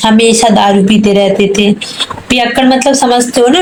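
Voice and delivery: normal talking voice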